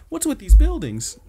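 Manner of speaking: high falsetto, put-on baffled outcry; mock bewilderment. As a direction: exaggerate a baffled complaint way up high. Redoes the baffled complaint, corrected.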